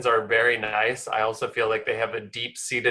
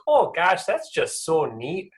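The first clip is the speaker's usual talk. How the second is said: put-on higher-pitched, sweet-as-pie Minnesota accent; 6 semitones higher, slower than usual. Midwest focus group praising an idea, Minnesota accent. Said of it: "That was Minnesota".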